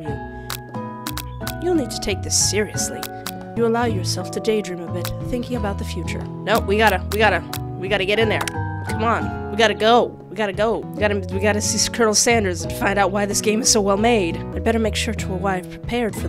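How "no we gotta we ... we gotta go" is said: normal talking voice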